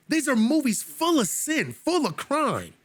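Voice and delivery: high, ringing cry on full of sin, full of crime; fiery sermon climax; preaching peak. Acted. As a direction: lift the church with it.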